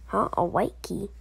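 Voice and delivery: ordinary delivery, nothing marked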